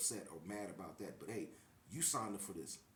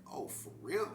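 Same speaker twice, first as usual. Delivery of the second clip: retold disbelief; 5 semitones higher, 5 dB louder than usual. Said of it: "And I'm like".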